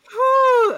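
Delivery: loud, high-pitched whew; exaggerated whew. Real, not acted. Co-host reacting to friend's thick accent, big whew.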